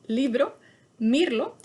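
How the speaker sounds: "libro, mirlo" met klemtoon op de eerste lettergreep, "LI-bro, MIR-lo", hoger en luider; stress on the accent. Shown as a demonstration. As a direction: leg de nadruk hoorbaar op LI en MIR. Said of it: "El acento está aquí".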